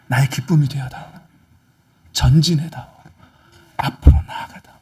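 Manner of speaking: hushed, sorrowful entreaty; grieving, pleading God. Acted. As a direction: plead gently with a beloved child, nearly whispering in places. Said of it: "속상해 하신다는 거예요".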